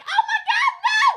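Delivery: very high, loud, cracking with laughter; laughing shriek. Real, not acted. Dog panicking at Molly, laughing shriek.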